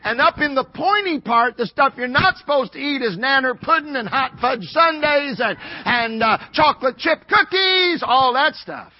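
high, loud pulpit delivery; rousing sermon shout; full-force preaching. Real, not acted.

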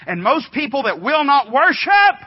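high, loud pulpit declaration. Real, not acted. Preacher to congregation about worship, loud declaration. Preacher's sudden swell on his point.

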